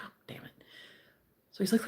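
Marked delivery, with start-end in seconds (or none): 0.0-0.5 muttered under the breath, barely audible; a private whispered groan of annoyance; whispered curse. Retold or imitated. Retold disappointment at MRI queue.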